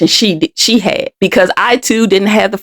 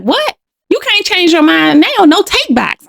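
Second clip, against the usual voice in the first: higher, mock-indignant man refusing to let her go; retold man's insistence; 8 semitones higher than usual. Man pinning down the girl, insisting in a higher mock-indignant voice. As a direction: play the man as pushy and put out. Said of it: "He's like".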